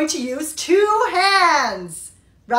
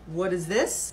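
stern officer; 8 semitones lower, 8 dB quieter than usual. Acted. Airport officer inspecting bag.

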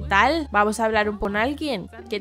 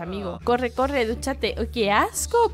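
ordinary delivery

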